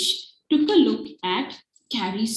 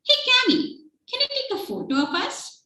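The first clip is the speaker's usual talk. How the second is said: Carrie's voice; 12 semitones higher, faster than usual. Acted. Carrie asks Cammy for photo.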